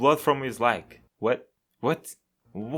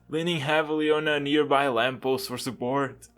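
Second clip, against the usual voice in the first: normal talking voice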